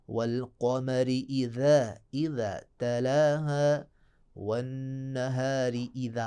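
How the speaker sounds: वल कमरी इज़ा said slowly, with a th in इज़ा; slow, deliberate practice reading; careful teacher pace; slow idha demo. Shown as a demonstration. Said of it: "अब बार बार इज़ा आ रहा है".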